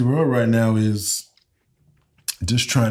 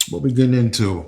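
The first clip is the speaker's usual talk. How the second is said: ordinary talk, nothing marked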